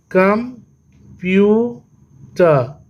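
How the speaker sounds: very slow "computer" as कं, प्यू, टर; long pauses splitting computer into three; syllable by syllable. Shown as a demonstration. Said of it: "ये भी three syllable हो गया". ("Computer" तीन हिस्सों में, रुक-रुककर)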